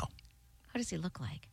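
hushed aside, barely voiced; slow whisper, almost to herself; a quick breathy whisper to the co-host. Real, not acted. Asks quietly while looking at images.

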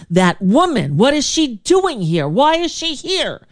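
mocking an angry host. Angry host complaining to the room, imitated high and rising. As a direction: ham up the offended radio host griping about her. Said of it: "started talking to everyone else in the room about me".

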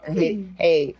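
normal talking voice

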